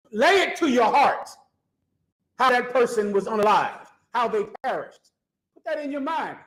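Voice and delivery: preaching. Acted. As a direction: hammer it home like a street preacher.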